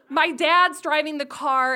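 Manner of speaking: ordinary talk, nothing marked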